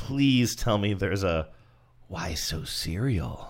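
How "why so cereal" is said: slow, hushed, drawn-out villain parody; softly intoned mock-sinister tagline; mock villain slogan. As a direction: drop low and stretch it out menacingly in jest. Joker catchphrase pun as ad slogan.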